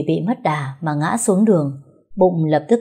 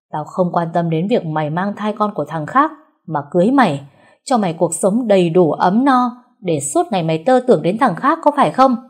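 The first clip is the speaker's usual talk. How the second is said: angry accusation; 3 dB louder than usual. Acted. Husband berating his wife, furious. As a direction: confront her with the jealous charge.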